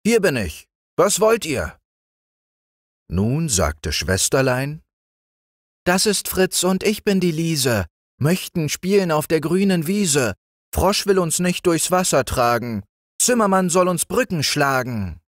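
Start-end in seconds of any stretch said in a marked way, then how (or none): 0.0-1.8 hohe, leicht gespielte Froschstimme. A narrator's reading. 5.8-15.2 hohes Mädchenstimmchen, leicht singend; Kinderstimme. A narrator's reading.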